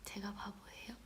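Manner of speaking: soft whispered question to herself; hushed murmur. Real, not acted. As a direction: whisper it sheepishly. Whispered aside after a gaffe.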